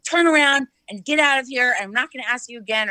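curt, bossy telling-off, retold; super rude. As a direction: imitate a rude security guard ordering someone out. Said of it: "super rude to me and was like".

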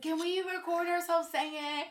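put-on high-pitched eager kid request; eager child. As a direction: beg like an excited little kid. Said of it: "go over their house and be like".

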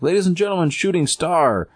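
high-pitched mock announcer flourish; show emcee. Host announces Shooting Star to crowd, high emcee call. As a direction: present the act with a showman's lift. Emcee closes the comic's act.

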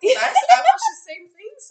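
squealing admission. Real, not acted. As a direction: blurt it out shrieking.

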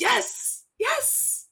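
high, excited double yes; thrilled yelps of agreement. Real, not acted. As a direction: jump in with eager agreement. Excited agreement about early arrival.

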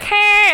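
high, loud squeak; Phoebe's squeal again. Retold or imitated. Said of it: "She's like".

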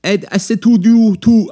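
high, loud, badly faked Russian accent; attempted Russian accent. Shown as a demonstration. Host failing Russian and apologising, mock Russian accent. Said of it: "Yeah, I can do Russian".

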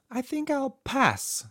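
high, slow, hesitant refusal; reluctant. A narrator's reading. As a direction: back out timidly in a high voice. Timid friend declines the ride.

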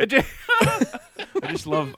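drawn out, high, laughing. Real, not acted. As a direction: stretch it out while laughing. Mocking line through laughter.

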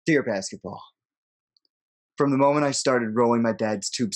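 dramatic poem reading. Acted. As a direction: read it like a farewell letter. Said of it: "You wanna read the poem for us".

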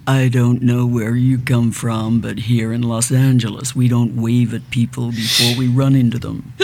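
put-on driving examiner in slow, low American accent; mock American. Driving examiner scolding her for waving, mock American accent. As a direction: put on a deep, unhurried Los Angeles American voice. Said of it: "And she said".

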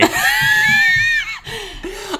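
high shrieking laugh; cringing shriek of laughter. Real, not acted. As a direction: burst into a squealing laugh. Reacting to own old text.

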